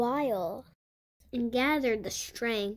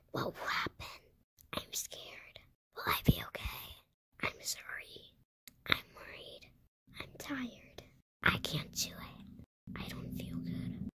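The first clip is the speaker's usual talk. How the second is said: put-on soft, scared child's whisper into the alpaca's ear; whispered worries; 14 dB quieter than usual. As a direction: confide your fears in a tiny whisper.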